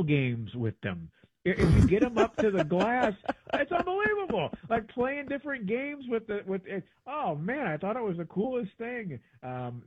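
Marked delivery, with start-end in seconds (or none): 1.5-9.2 excited, much higher pitch; amazed enthusiasm. Real, not acted. Telling co-host about games with orangutans, amazed and excited. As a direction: gush in high-pitched wonder.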